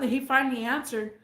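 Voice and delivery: ordinary talk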